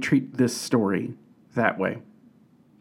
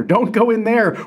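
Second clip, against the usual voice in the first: higher-pitched mock shout at the screen; a contained, acted-out yell of warning; mock moviegoer shout; 8 semitones higher than usual. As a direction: imitate a moviegoer warning a film character. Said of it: "moviegoers shouting at the main character".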